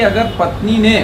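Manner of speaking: normal talking voice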